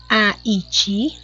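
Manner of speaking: "aiti" broken into separate syllables. Shown as a demonstration. Teacher correcting the syllable exercise, syllable by syllable. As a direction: say "aiti" as distinct beats, i-a-te. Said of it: "ó, como eu separei".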